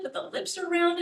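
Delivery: ordinary talk, nothing marked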